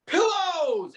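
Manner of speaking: high, drawn-out storyteller's cry; mock scream. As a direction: shout it lightly as if plummeting. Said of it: "scream a word".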